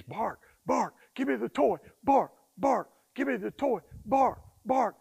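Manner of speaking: high, yappy puppy voice begging for the toy; demanding puppy. Retold or imitated. Trainer voicing a puppy who wants his toy, high and yappy. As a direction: bark it out like a pushy little pup. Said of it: "Imagine he's a puppy".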